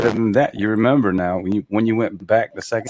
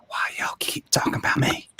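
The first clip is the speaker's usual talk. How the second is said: put-on Southern accent whisper; 6 dB quieter than usual. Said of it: "in, like, a Southern accent".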